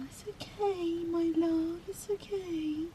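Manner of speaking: high, very soft comforting voice; soothing. Real, not acted. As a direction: hush a frightened pet tenderly. Soothing her shaking dog.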